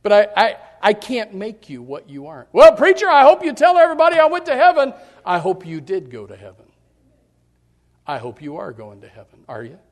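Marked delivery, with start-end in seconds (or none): 2.5-4.9 high, loud, hurried plea to the preacher; a hammed-up church member, much higher and louder; pleading congregant. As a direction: beg him to say you made it to heaven. Acted congregant asking for praise.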